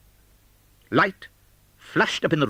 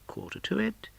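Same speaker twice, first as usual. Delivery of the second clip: whispered count; 11 semitones higher, 8 dB quieter than usual. Acted. Scrooge counts the chimes.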